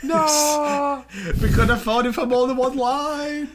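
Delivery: high-pitched protesting wail through laughter; mock wail. Friend jokes about affording the star, mock wail. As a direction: whine it in hammed-up dismay.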